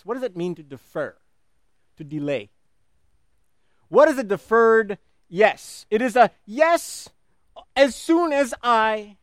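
ordinary talk, nothing marked

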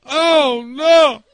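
loud, high mock lament; grandfather's dismay. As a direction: cry it out like a stricken grandfather. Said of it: "and then he went".

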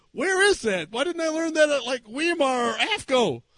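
high-pitched baffled complaint; mock exasperation. Pastor wonders why seminary skipped this, mock exasperated. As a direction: play up comic indignation.

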